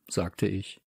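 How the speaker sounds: ordinary delivery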